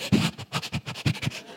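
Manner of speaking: mock panicked breaths; panicked gasping. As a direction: gasp in voiceless bursts, as if panicking. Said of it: "like, inside, I'm like".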